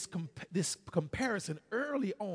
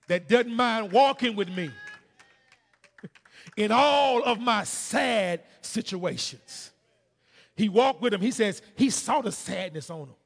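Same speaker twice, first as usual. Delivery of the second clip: full-force preaching; 5 semitones higher, 11 dB louder than usual. Acted. Preacher testifying to the church about his Savior, full-force preaching.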